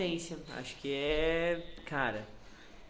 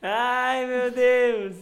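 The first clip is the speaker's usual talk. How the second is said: loud, higher "ai, meu Deus" through laughter; laughing exclaim; 8 semitones higher, 13 dB louder, much slower than usual. Real, not acted. To the live chat, loudly exclaiming through laughter. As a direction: burst out still laughing.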